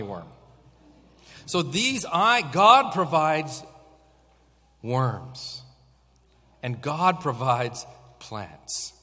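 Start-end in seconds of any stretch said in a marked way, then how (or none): none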